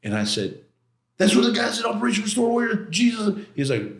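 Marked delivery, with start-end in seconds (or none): 1.2-3.4 high, fast, excited blurting; amazed outburst. Retold or imitated. Client recognising the prophecy to lawyer, amazed.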